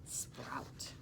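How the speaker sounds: Sprout whispered very softly, no voice; whispered word. Shown as a demonstration. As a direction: breathe the word Sprout as a secret. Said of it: "That means they just start to grow".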